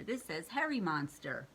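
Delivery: usual speaking voice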